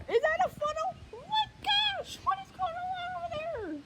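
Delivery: panicked, rising cry of disbelief; frightened high cry. Real, not acted. Filming the storm, she spots a funnel and cries out in fear. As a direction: voice leaps up in real alarm at the funnel.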